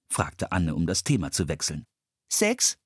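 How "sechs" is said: put-on a much higher small boy's voice, proud; "Sechs" in a bright, high little boy's voice. A narrator's reading. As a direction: say "Sechs" like a proud six-year-old. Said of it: "sagte der kleine Junge".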